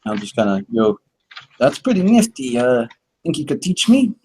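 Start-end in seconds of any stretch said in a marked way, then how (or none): 1.6-4.1 put-on higher, slipping Boston accent; a fading hint of Boston. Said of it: "I'm trying to do a Boston impression".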